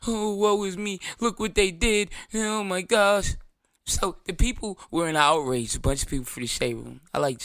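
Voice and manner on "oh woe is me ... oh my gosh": higher, slow hammed-up whimpering lament; exaggerated mock woe, dragged out; mock crying. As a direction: mimic a crybaby landlord. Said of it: "Don't cry".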